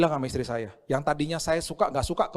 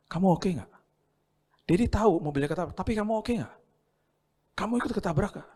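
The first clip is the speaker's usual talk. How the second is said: concerned father; 7 semitones higher than usual. Retold or imitated. Father gently asking his son after the crash. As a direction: ask gently if he's hurt.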